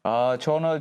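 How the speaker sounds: normal talking voice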